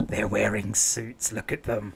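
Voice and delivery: slow, low teasing voice; mock sneer. Mocking graduates in suits at interviews, slow and low.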